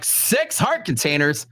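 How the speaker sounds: slow, emphatic disbelief, every word hit hard; stretched out like a shocked all-caps text. Retold or imitated. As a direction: spell it out like it's in capitals. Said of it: "Like, in all capital letters".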